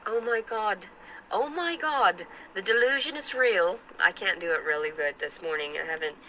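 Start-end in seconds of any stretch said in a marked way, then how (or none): 0.1-3.8 high, put-on British exclamation; mock British, climbing high; attempted British accent. Shown as a demonstration. Accent attempt continues, pitched up.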